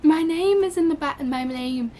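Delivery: excited disbelief. Real, not acted. Repeating in disbelief.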